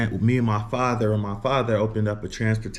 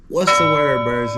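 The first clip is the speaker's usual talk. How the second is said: hype greeting; 4 semitones higher, 6 dB louder than usual. Real, not acted. Vlog greeting shout-out.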